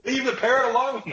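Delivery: squeaky high voice breaking into laughter; can barely get it out for laughing. Real, not acted. Host repeating the punchline to his co-host, laughing.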